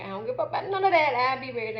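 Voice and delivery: put-on high, loud, fast teen comeback; loud retort. Daughter tells mom she's ready, loud fast retort. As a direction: daughter brushing off mom's worry.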